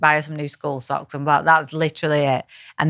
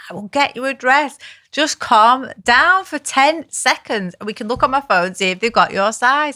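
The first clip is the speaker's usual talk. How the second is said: pleading; 8 semitones higher than usual. Retold or imitated. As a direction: coax a screaming child to calm down. Mother pleading with screaming child.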